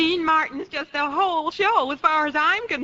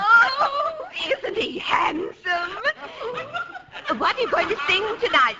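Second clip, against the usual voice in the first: gushing, breathless adoration; swooning fan; 7 semitones higher than usual. Acted. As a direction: melt over the handsome star. Fan swooning over [name].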